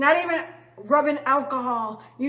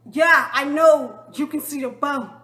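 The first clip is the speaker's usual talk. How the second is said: louder, fed-up sarcasm; exasperated reply; 8 dB louder than usual. Retold or imitated. Injured girl answering staring shoppers, loud and exasperated. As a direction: snap back, sick of being told.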